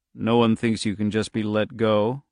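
ordinary delivery

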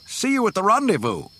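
high, loud farewell call. A narrator's reading. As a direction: call it out across the distance. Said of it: "Wedge called after him".

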